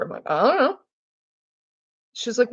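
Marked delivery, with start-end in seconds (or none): 0.3-0.8 high, rising don't-know; retold evasive singsong shrug; mock cluelessness. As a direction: play dumb with a high lilt. Stonewalling teacher's questions.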